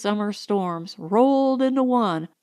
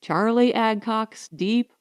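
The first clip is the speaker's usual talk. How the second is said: ordinary delivery, nothing marked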